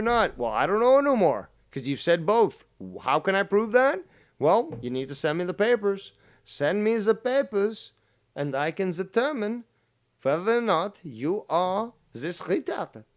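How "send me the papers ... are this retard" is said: put-on German accent. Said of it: "That was actually a German accent".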